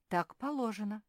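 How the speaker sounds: plain reading voice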